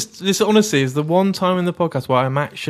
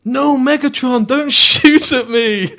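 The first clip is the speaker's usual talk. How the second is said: high, loud, pleading cry; a hammed-up desperate plea, slowed down; Shia LaBeouf impression; 10 semitones higher, 4 dB louder, slower than usual. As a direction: beg Megatron in a panicked, laughing wail. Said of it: "one of the worst impressions".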